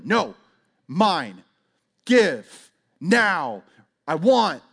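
punchy one-word toddler demands, imitated; demanding little child. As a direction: fire off each word separately, like a demanding toddler. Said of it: "start as very little children".

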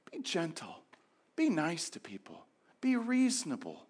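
sigh, then whisper the advice; gentle whisper. Acted. Pastor gently urging the congregation, whispering. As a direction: hush it out like a soft plea.

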